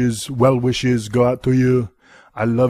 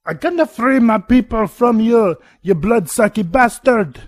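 much higher, louder boy's voice with a mock Mexican accent; mariachi boy; 9 semitones higher, 4 dB louder than usual. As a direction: squeak it up high like a defiant little boy. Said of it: "my line as the little mariachi boy".

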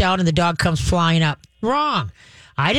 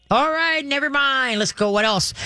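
high sheepish dog voice; 7 semitones higher than usual. Pretend.

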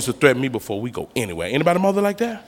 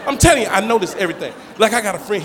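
ordinary talk, nothing marked

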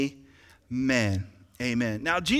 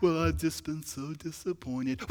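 mock disappointment; 5 dB quieter than usual. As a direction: play a mopey, let-down believer. Voices a disappointed believer.

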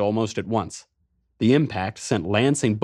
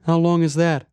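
put-on high, small child voice; little boy; 7 semitones higher, 3 dB louder than usual. A narrator's reading. Boy tells stranger about lost mother, little boy's voice. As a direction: speak as a young boy, pitched high.

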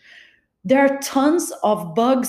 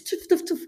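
dabbing sound effect; 9 semitones higher, 4 dB quieter than usual. Retold or imitated. Imitating paper towel dabbing.

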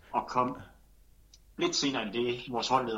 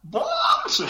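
shrieked, much higher and louder, half laughing; mock shout; 9 dB louder than usual. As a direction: yell it out like fans heckling from the stands. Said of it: "vi stod og råbte". (råbt, højt og grinende)

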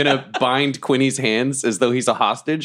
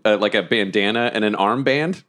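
ordinary delivery

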